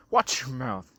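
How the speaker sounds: ordinary delivery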